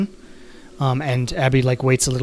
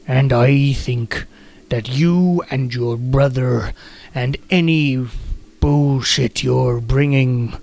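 Coster's menacing voice; slower than usual. Acted. Guard Coster threatens prisoner Abby, slow and menacing. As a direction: drawl each threat out like a brute jailer. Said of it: "what voice do I want for him?".

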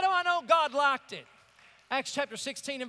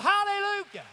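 exultant hallelujah; 6 semitones higher than usual. Real, not acted. Praise shout after whoop.

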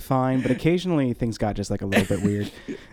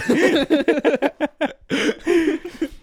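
loud, high, long laugh; high burst of laughter; 13 semitones higher, 4 dB louder than usual. Real, not acted. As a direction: let out a big high laugh. Laughing at the edit idea.

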